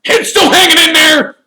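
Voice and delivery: high, boisterous shouting; roaring Sir Patrick. A narrator's reading. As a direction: holler a teasing greeting at full force. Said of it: "he roared".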